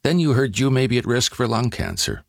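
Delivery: ordinary talk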